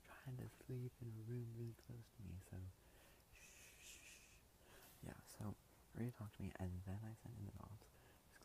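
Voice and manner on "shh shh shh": soft voiceless shh, drawn out; shushing. Real, not acted. Shushing so parents sleeping nearby stay asleep, hushed shh. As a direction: hush the room so no one wakes. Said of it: "I'm talking really quietly because my parents are".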